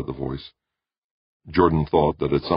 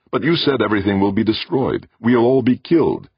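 louder, higher alarmed protest; 8 semitones higher, 6 dB louder than usual. A narrator's reading. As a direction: push back in alarm. Jordan protests.

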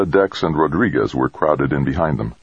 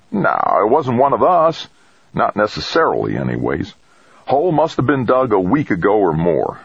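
higher-pitched, folksy character voice; bleak crewman Craig; 5 semitones higher than usual. Acted. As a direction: explain the damage, emphatic and folksy. Voices Craig explaining the sabotage.

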